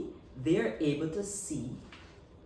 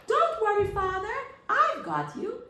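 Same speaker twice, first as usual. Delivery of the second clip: high, bright little son's voice calling out; small eager son; high little spider voice; 7 dB louder than usual. Acted. Smallest spider son reassures his falling father, high and eager.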